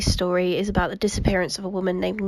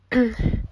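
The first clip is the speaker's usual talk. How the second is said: throat clear; 4 semitones higher than usual. Real, not acted. Clears throat mid-story.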